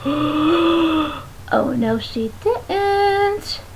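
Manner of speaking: slow, quiet, drawn-out "no, she didn't"; sassy disbelief. Retold or imitated. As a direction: draw it out with sassy shock. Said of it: "I was just like".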